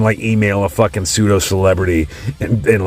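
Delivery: ordinary talk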